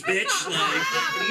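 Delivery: high, crying shout; mock shout. Boasting to the girl about his youth, a hammed-up shout. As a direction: yell it like a wild boast.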